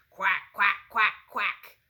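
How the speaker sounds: loud, booming duck quacks; Daddy Duck voice. Acted. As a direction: call like a big father duck. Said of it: "Daddy Duck says".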